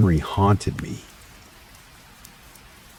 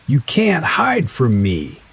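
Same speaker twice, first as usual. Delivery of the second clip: high, slow, menacing rage; furious; 9 semitones higher, 3 dB louder, slower than usual. A narrator's reading. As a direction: threaten with fury. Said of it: "now filled with rage".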